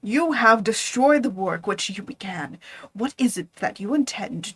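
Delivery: hoarse urgent whisper; smothered whisper. Acted. Monster confronts his creator in a smothered whisper. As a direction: whisper it like a threat held back. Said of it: "in a smothered voice".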